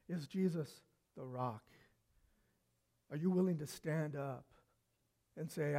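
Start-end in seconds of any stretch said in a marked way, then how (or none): none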